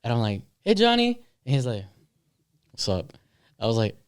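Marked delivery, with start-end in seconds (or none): 0.6-1.2 high-pitched, lilting hail; friendly call. Retold or imitated. Friend arriving back greets Johnny, high friendly call. 2.7-3.1 low, soft, curt imitation; sullen reply. Upset Johnny answering his friend, low sullen reply.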